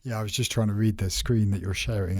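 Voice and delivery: normal talking voice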